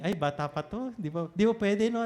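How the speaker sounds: usual speaking voice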